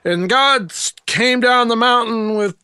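high, slow, hammed-up sermon voice; mock preaching. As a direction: ham up a fiery preacher. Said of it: "you're preaching up a storm".

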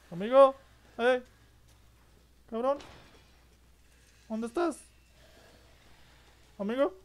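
high taunting call of amigo; mock Spanish calling. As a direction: call out like a mocking bandit. Mocking Spanish calls to villagers.

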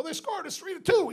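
high, excited call through the wall; gleeful shout to the next room; excited yell. Retold or imitated. Yelling news through a wall.

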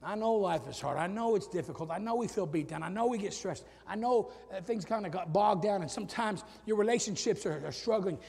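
ordinary talk

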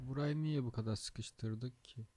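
ordinary talk

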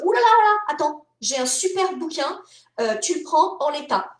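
bursting out high and loud on ouh là là, then keen; eager editor pitch. Retold or imitated. An editor pitching a book to a scout, mock excited.

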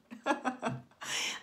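breaking into a laugh; amused laugh. Real, not acted. Speaker laughing at her own mispronunciation, amused laugh. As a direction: laugh at your own slip.

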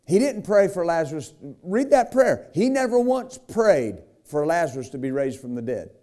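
rising, pressing sermon delivery with dramatic pauses; preaching. Acted. Preacher retelling Lazarus to the congregation, fervent preaching. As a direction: preach it to the church, building and dropping.

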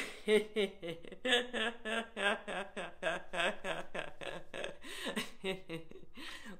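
long rolling burst of laughter; laugh on exhale. Shown as a demonstration. Yoga leader laughing on the way down from a stretch, rolling laugh. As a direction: laugh all the way down the breath. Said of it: "laugh on the way down".

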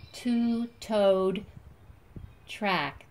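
normal talking voice

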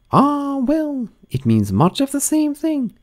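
high grand duchess voice; imperious plummy grande dame. Acted. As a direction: purr like a grand old duchess.

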